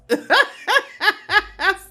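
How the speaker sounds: high laughter burst. Real, not acted. Laughing about the friend's coughing on the phone.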